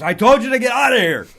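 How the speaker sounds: hammed-up insult comic throwing him out; Don Rickles mock rage. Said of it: "in that Don Rickles over the top, wonderful way".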